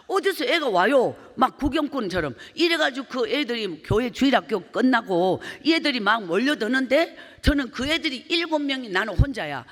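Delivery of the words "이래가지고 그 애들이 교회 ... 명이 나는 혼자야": usual speaking voice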